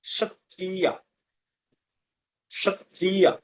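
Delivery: সত্রিয়া with initial sh and final ya; Sanskrit pronunciation. Shown as a demonstration. Teacher repeats the Sanskrit form for students, সত্রিয়া with sh. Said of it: "এরকম উচ্চারণ হবে".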